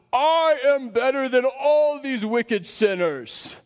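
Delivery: mock a self-righteous Pharisee, stretching every word; boastful Pharisee. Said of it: "the Pharisee goes into the temple, and he goes".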